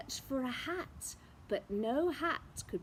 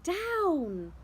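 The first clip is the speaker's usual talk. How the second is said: higher, louder cry of down; 6 semitones higher, 5 dB louder than usual. Acted. Witch crying out to land again. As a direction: call it out urgently. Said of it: "cried the witch".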